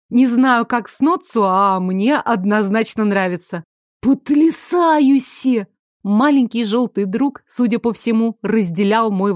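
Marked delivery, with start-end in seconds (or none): 4.0-5.7 high, very slow gasp of wonder; amazed creature voice. A narrator's reading. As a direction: stretch it out in delight. Sho admires her new look.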